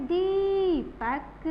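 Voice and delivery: Deepak slowly with दी stretched out; long stressed दी. Shown as a demonstration. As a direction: hold the ee of Deepak, then drop to पक. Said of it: "तो हम क्या बोलेंगे?". (दीपक में दी लंबी खींचकर)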